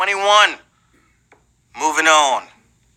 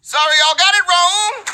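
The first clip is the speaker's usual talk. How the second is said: gleeful gotcha; 14 semitones higher than usual. Acted.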